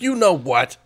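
high, loud mock outrage; imitated fed-up retort. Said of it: "She's like".

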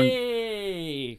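high, drawn-out cheer. Real, not acted. Co-host hearing the car passed its test, cheering. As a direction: celebrate with one long falling whoop.

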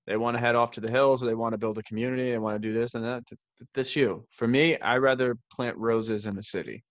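ordinary talk

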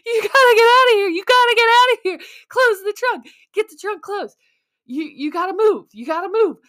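high, loud panicky self-pep-talk; woman alone at dark pickup, panicking; panicked self-talk. Retold or imitated. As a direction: urge yourself to flee in a frantic rush. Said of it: "So I'm like".

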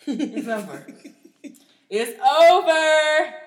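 slow, loud, high drawn-out wail; mock wail. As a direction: wail it like a lost cause. Dramatic mock lament.

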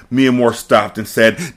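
ordinary delivery

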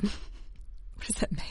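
slow, whispered aside to herself; a slow, bemused whispered aside; puzzled whisper. Real, not acted. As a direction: breathe it out half to yourself, baffled. Puzzled aside mid ad read.

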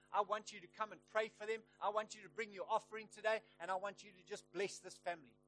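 rally the church with passion; fervent preaching. Retold or imitated. Preacher retells calling church to give.

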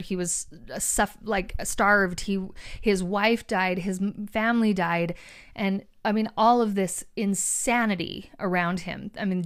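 ordinary delivery

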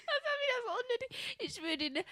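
high laughing voice. Real, not acted. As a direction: giggle it out. Laughing after joke.

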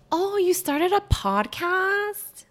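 high, slow, sing-song mock voice; mocking annoying acquaintance. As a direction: drag it out like a fake-nice busybody. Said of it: "people who are like".

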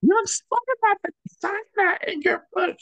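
very high squeaky put-on voice; mock squeal. Teasing friend about her photo shoot, very high squeal.

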